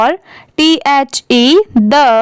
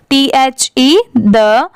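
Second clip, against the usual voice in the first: usual speaking voice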